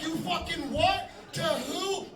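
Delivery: high, slow disbelief; can't believe what he's hearing; incredulous. Retold or imitated. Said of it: "I'm like".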